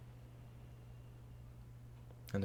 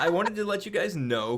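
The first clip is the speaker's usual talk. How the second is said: much higher laughing voice; 9 semitones higher, 5 dB louder than usual. Real, not acted. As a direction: giggle through it.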